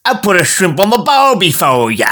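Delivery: put-on Australian accent slipping towards pirate; attempted Australian accent. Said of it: "That's like a pirate".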